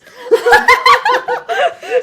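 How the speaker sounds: high loud burst of laughter; big laugh. Real, not acted. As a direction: crack up at your own joke. Laughing at own joke.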